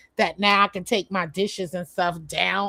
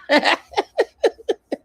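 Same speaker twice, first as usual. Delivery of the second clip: short high laugh; a quick laugh at herself; 11 semitones higher, 5 dB louder than usual. Real, not acted. Laughs about roller setting hair.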